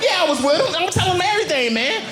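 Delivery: high, keen voice spilling everything; eager confession. Acted. Suspect happily confessing to the cops, high and eager. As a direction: blurt it all out gladly. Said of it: "And I'm like".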